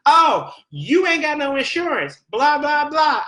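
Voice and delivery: high, loud mock yell across a counter. Pharmacy clerk yelling about no insurance, high mock yell. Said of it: "yelled across".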